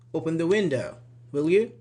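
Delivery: "will you" rising after a firm request; rising tag intonation. Shown as a demonstration. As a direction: lift the end of "will you", expecting action. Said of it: "we use rising".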